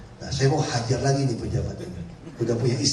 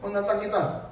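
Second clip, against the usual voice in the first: higher-pitched mock challenge; taunting challenge; 8 semitones higher than usual. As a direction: imitate officials daring him. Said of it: "Mereka tantang saya".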